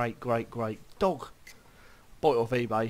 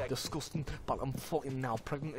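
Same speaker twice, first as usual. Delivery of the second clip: normal talking voice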